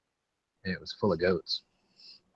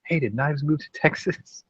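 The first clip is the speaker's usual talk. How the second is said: mimicking a friend; 8 semitones higher, 5 dB louder, slower than usual. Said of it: "Night Strike going".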